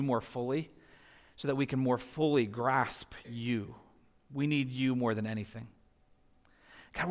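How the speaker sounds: soft, low congregational prayer. Real, not acted. Pastor praying for the congregation, hushed and earnest prayer. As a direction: pray gently and unhurried, voice sinking toward the amen.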